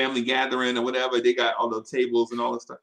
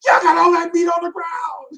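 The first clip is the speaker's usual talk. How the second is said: very high, loud shrieking complaint; frantic auntie; 11 dB louder than usual. Retold or imitated. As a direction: shriek it like an auntie upset over the meat. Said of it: "She worried".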